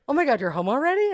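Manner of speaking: fast, high, loud surprised mom; quick high astonished mother. Retold or imitated. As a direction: mom startled she's back early.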